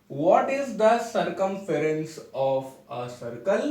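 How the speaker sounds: very slow, each word spaced for note-taking; slow dictation. Shown as a demonstration. Teacher dictating a definition to students, very slow and even. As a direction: spell it out slowly so students can write.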